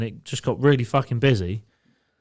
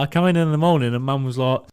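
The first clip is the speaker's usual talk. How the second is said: normal talking voice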